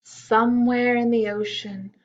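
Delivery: ordinary delivery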